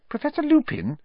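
put-on high, hesitant girl's voice; Hermione, timid. A narrator's reading. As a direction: pipe up timidly to a teacher. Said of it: "said Hermione timidly".

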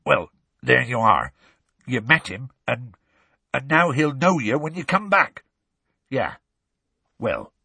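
halting, thick-voiced remarks; Hagrid speaking thickly. A narrator's reading. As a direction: talk haltingly through a bleeding nose. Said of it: "said Hagrid thickly".

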